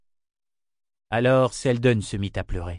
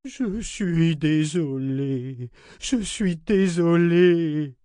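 slow, higher-pitched tearful drawl; plaintive, drawn-out regret; sniffling apology; 6 semitones higher, much slower than usual. Acted. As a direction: drag it out like a sorry, snivelling child. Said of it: "répétait Parangon en reniflant".

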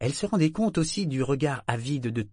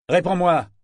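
higher, louder barked command; harsh order; 8 semitones higher, 8 dB louder than usual. Acted. Kyle commanding the figurehead to answer, loud order. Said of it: "ordonna Kyle".

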